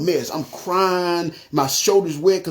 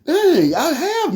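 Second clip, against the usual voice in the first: high, louder retold "caught out" reaction; stumped realization; 11 semitones higher, 3 dB louder, much slower than usual. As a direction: blurt it high, like someone just stumped. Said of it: "he got me stuck".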